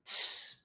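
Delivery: voiceless cymbal "tss"; demonstrated soft "tss"; English T hiss. Shown as a demonstration. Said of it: "Som do T".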